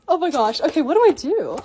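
ordinary talk, nothing marked

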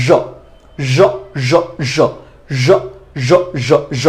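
জ as a soft zh like measure, repeated; zh sound. Shown as a demonstration. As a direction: glide into a zh buzz. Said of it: "এটার উচ্চারণটা হচ্ছে". (জ নরম ঝ-এর মতো)

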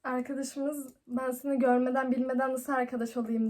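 normal talking voice

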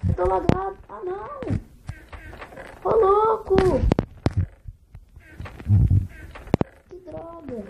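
high, loud lag frustration; frustrated yelling. Real, not acted. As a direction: cry out at the lag. Frustrated gamer lagging in match.

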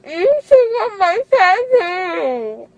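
loud crying wail. Real, not acted. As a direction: sob it out, high and loud. Drugged girl wailing over tattoo.

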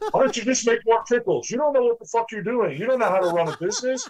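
high, mocking voice of an online hater. Owner mocking a hater who says he runs his pickle business wrong, high sneer. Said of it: "You always have that one percent of haters".

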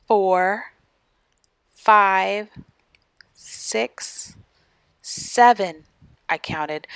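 ordinary delivery, nothing marked

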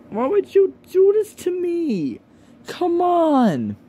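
high, strained pleading whine; exasperated, near-tears high whine; distressed whine. Real, not acted. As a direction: plead in real despair, voice cracking up high. Upset over fetish videos of him.

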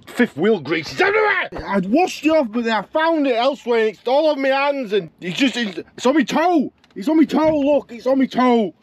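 real exasperation at grease on his toe; exasperated rant. Real, not acted. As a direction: rant at the grease, pitch climbing. Ranting about grease everywhere.

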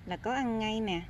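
normal talking voice

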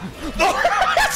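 high burst of startled laughter; shrieking laugh. Real, not acted. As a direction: laugh in a squeal. Laughs after scaring NPC.

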